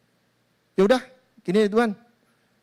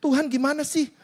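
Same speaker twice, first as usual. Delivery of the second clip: higher pitched, accusing whine at God; indignant complaint; 6 semitones higher than usual. Acted. Believer protests to God over his wrecked heart, indignant. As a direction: demand to know why He did not help.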